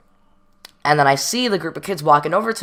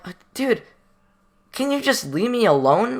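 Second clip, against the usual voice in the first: exasperated plea; 8 semitones higher than usual. Retold or imitated. Pleading with staff member.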